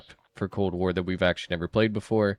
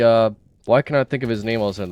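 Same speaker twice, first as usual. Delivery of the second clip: ordinary delivery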